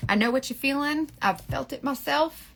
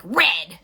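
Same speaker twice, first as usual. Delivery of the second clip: "red" punched out louder; stress on red; 5 semitones lower, 5 dB louder than usual. Shown as a demonstration. Explaining the mascara isn't that vivid, "red" said with loud stress. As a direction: hit "red" hard as the overdone version.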